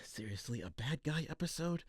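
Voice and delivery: soft, quick muttered complaint; grumbling aside. Real, not acted. Announcer complaining to producer, muttered. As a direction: gripe under your breath.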